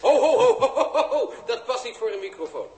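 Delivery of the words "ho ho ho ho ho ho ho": flustered laugh. Acted. Host cuts off toilet talk.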